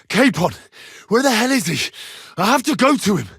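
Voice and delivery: loud, high urgent calls for a lost friend; urgent calling. Acted. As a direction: shout for him, worried and pressing.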